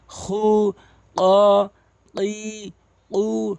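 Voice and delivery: each syllable from "হা" to "কু" held long and level; even, chant-like long vowels from "হা" to "কু"; syllable reading drill. Shown as a demonstration. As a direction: recite "হা, হে, হু" onward one by one, pausing between. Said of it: "আপনি দ্রুত উচ্চারণ করবেন".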